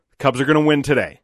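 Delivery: emphatic pep talk at normal pace; retold confident assertion. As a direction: declare it firmly, as if correcting a doubter.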